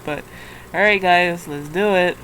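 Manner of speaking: normal talking voice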